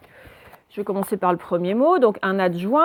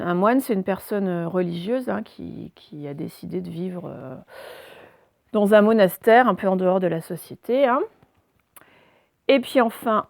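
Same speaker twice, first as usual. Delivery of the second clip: ordinary talk, nothing marked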